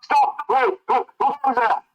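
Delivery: high-pitched alarmed shout; urgent, high in-character yell. Acted. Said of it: "he shouts".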